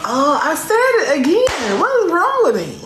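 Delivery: mock dismay. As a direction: gasp at yourself in mock horror. Mock dismay at own words.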